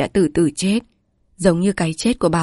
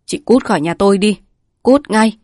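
louder, rising to a higher shout at cút ngay; furious shrieking order; 3 semitones higher, 6 dB louder than usual. A narrator's reading. Man driving his sister-in-law out, furious shriek. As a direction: throw her out of the house with a cry of pain. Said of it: "đau đớn rít lên".

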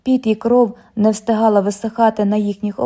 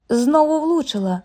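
crowd shouting; 6 semitones higher than usual. A narrator's reading. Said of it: "кричали вони".

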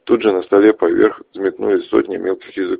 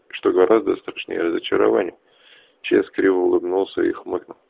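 ordinary delivery, nothing marked

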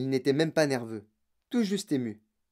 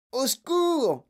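high cry for help; terrified old grandfather's cry; panicked yell; 10 semitones higher, much slower than usual. A narrator's reading. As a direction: wail for help, high-pitched. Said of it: "hurla grand-papa Joe".

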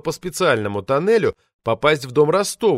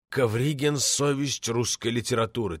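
put-on softer, slurred drunken whisper; 6 dB quieter than usual. A narrator's reading. Drunk poet whispers his grievance to the narrator. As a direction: lean in and whisper it, reeking of booze. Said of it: "зашептал многонедельным перегаром".

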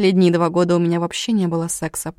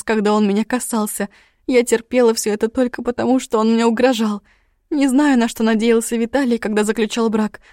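high, loud tearful outburst; angry crying; 4 semitones higher, 4 dB louder than usual. Acted. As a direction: pour out the pain, crying. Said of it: "Меня прорвало".

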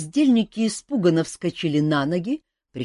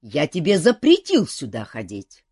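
angry scolding; 7 dB louder than usual. A narrator's reading. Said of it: "сердито продолжил король".

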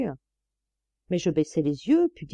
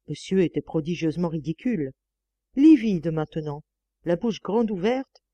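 plain reading voice

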